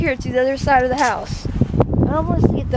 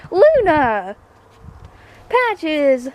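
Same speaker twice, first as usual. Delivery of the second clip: high, loud sing-song call; calling out pets; 5 semitones higher, 3 dB louder, much slower than usual. Real, not acted. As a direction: call the cats over. Calling cats.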